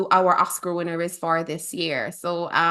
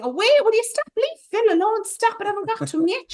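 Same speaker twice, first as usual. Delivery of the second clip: playful scolding; 13 semitones higher, faster than usual. Real, not acted. Scolding co-host for interrupting.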